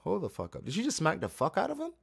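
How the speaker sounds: a sudden high, fast "wait, what?"; gasping at the slap on screen; stunned disbelief. Real, not acted. Viewer reacting to a sudden slap, stunned and high-pitched.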